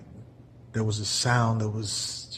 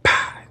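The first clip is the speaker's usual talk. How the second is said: snake strike; 7 dB louder than usual. Retold or imitated.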